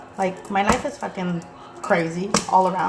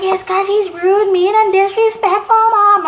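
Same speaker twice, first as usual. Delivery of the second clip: put-on much higher, louder whiny child voice; whiny kid complaint; 12 semitones higher, 8 dB louder, slower than usual. Son complaining to mom about his rude dad, in a high whine. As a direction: whine it like a sulking boy.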